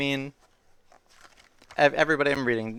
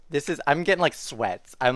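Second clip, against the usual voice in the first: ordinary delivery, nothing marked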